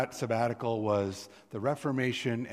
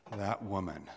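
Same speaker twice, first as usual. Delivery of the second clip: soft, dropped dismissive "That woman."; 3 semitones lower, 6 dB quieter than usual. Shown as a demonstration. Preacher showing a scornful reading. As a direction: toss off "That woman." quietly, unimpressed. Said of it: "it could be like".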